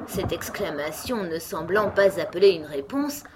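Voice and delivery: plain reading voice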